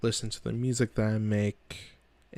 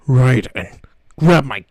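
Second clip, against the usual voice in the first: loud gruff soldier growl; Solid Snake voice; 15 dB louder than usual. Retold or imitated. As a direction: growl it like a grizzled spy. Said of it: "a Solid Snake impression".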